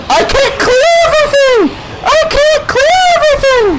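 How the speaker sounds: very high, wailed shriek; desperate screaming. Real, not acted. Gamer overwhelmed by enemies, high desperate wail.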